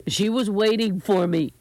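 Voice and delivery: upset, defensive explanation; distressed pleading. A narrator's reading. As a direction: plead your case. Grandson frantically justifying himself.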